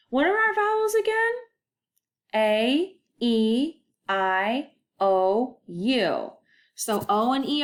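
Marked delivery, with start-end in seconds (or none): 0.1-1.3 much higher, quick, sing-song question; playful teacher question; playful quiz. Acted. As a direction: tease the answer out of the kids.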